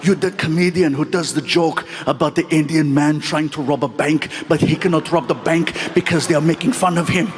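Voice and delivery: put-on low, deliberate Austrian-accented star voice; Arnold Schwarzenegger. Star recognizing the comedian, low accented impression. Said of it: "and he grabbed it".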